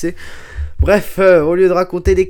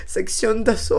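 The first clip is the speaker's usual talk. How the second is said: higher, mock German accent; 5 semitones higher than usual. Shown as a demonstration. Host repeating the band name, put-on German accent. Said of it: "pourquoi je dis ça avec l'accent allemand".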